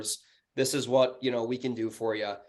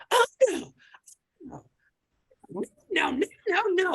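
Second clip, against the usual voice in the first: very high mock yell, mimicking her; imitated yelling tenant. Landlord mimics the lady yelling at him, high mock screech. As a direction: shriek it like an outraged tenant, mockingly. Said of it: "she starts yelling at me".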